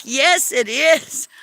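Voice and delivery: mock indignant owner, lightly voiced; stubborn car owner. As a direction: plant your feet, it's his car. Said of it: "He's like".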